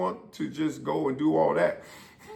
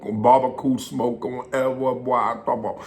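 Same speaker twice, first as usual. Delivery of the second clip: ordinary delivery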